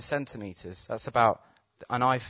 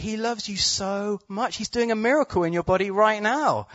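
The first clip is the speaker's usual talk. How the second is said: high, louder, joyful insistence; excited encouragement; 9 semitones higher, 4 dB louder than usual. Retold or imitated. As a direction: pump him up with the good news. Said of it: "getting him excited about that".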